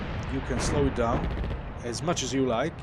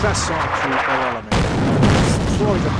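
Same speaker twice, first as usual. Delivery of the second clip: rallying shout; 3 semitones higher, 13 dB louder, faster than usual. Pretend. Urging troops in strategy game.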